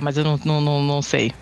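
usual speaking voice

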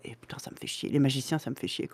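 half-whispered curse under the breath; muttered griping. Real, not acted. Player grumbling to a friend about magic characters, muttered. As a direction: grumble it low, fed up.

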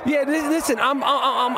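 flustered through laughter; host cracking up at the caller; laughing. Real, not acted. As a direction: stammer while laughing.

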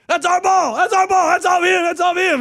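high, loud comic claim of the ball; funny coach voice. Retold or imitated. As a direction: squawk it like a coach begging for the call. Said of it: "he would say it funny".

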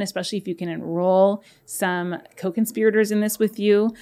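ordinary delivery

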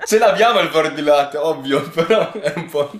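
laughing through the words. Real, not acted. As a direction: laugh it out defensively. Laughing defensive joke.

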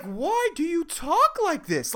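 high, slow mimicking lilt; creepy childlike voice. Barista mocking customer's way of talking, high and creepy. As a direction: parody his child-pitched drawn-out speech. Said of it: "the octaves of a child".

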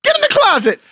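high, rapid mock yelling; mock bossy order. Host acting out the wife ordering her husband, mock yelling. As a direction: order them about in pretend exasperation. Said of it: "Are you gonna be like".

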